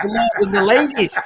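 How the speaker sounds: mock Polish royalty. Boasting to mates as a mock count, lightly posh. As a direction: play the grand count, only half-serious. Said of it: "I'm a Polish count".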